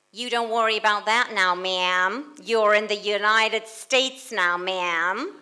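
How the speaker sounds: booming amateur Texan drawl, drawn out; attempted Texan accent. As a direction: have a rough go at a Texan lawman's accent. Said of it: "shall I try a, a Texan accent?".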